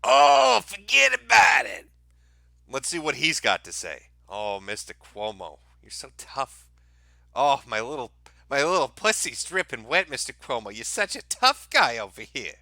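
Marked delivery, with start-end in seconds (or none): 0.0-1.9 loud, high shout, hammed up; mock tough-guy shout. Host mocking Cuomo's tough talk, with a loud hammy shout. 4.3-12.5 mock swooning fan. Host mocks Cuomo as sultry fan.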